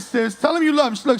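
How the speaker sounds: ordinary talk, nothing marked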